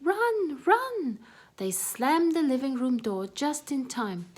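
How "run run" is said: much higher, louder run cry; high loud panicked command; urgent. Acted. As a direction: urge her friend to flee. Mice flee from the cat.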